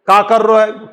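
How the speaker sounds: Braj-accented "का कर रो है". Shown as a demonstration. Teacher contrasting Braj speech with standard Hindi, Braj accent. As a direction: say it the Braj way, with shortened dialect vowels. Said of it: "लेकिन उसका जो accent है".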